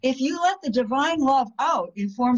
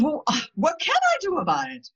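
helpless woman; 8 semitones higher than usual. Retold or imitated. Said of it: "And she said".

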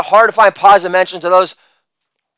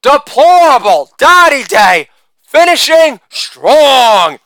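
usual speaking voice

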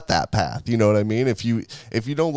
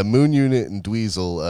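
usual speaking voice

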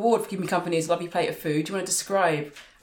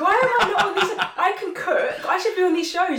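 laughing; 13 semitones higher, 5 dB louder than usual. Real, not acted. Jokey outrage at being left out.

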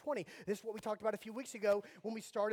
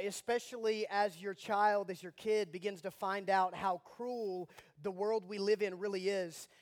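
ordinary delivery

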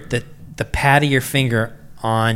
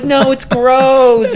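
high, loud, stretched-out mock whine; distressed wail; 13 semitones higher, 7 dB louder, much slower than usual. Injured man refusing to show woman his finger, distressed wail. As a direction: wail it out slowly, hammed up.

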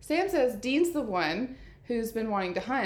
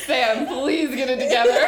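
mock scolding; 10 dB louder than usual.

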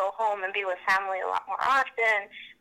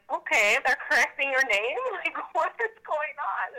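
higher-pitched, incredulous mom; mock-baffled, pitched up; baffled disbelief; 7 semitones higher than usual. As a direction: lightly imitate a mother who can't believe it. Mom's baffled reaction, retold.

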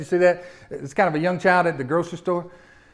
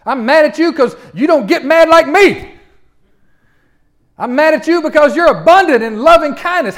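high, loud, mad tirade; Jonah; 10 semitones higher, 10 dB louder than usual. Acted. Jonah scolding God for relenting on Nineveh, loud anger. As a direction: rail at God in outraged disbelief. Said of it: "You ever seen a preacher mad?".

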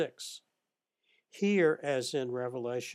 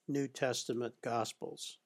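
ordinary talk, nothing marked

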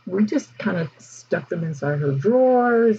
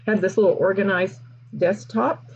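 ordinary delivery

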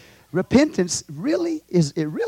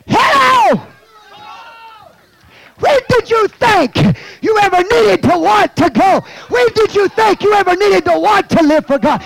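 high, loud preacher's shout; fiery preaching; 10 semitones higher, 6 dB louder than usual. Real, not acted. Preacher challenges his church about wanting to go, fiery shout.

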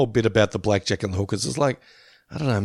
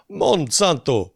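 slow, higher Jamaican cartoon accent; Hermes accent; 4 semitones higher, slower than usual. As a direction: savour the word in a put-on Jamaican accent. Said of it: "fun to say in a Hermes accent".

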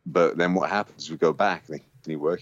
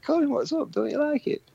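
very high worried coo; high concerned lady. Retold or imitated. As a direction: plead sweetly like a fussing executive. A&R woman asks Colin.

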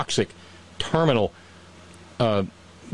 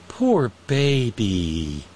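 mocking baby talk; much slower than usual. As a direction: drag it out, mock sympathy. Mocking the fired forecaster.

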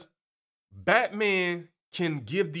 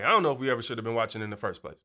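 ordinary talk

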